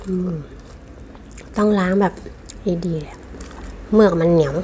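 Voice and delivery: usual speaking voice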